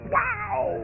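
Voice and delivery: startled yelp. Retold or imitated. Startled at being called out.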